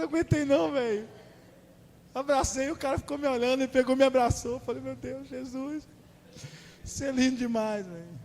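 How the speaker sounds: retold emotional outburst; overwhelmed gushing. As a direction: gush like a fan meeting his hero. Retelling hugging the actor Jesus.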